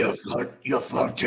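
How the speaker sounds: low, husky scary-voice demo; demon voice. Shown as a demonstration. Host coaxing a shy girl to perform, low demon voice. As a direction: drop it into a half-whispered demon voice. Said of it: "do it in your demon voice".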